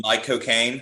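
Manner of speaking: a lightly voiced Caine catchphrase; mock Cockney delivery of the pun; hint of Michael Caine; Michael Caine impression. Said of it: "just saying".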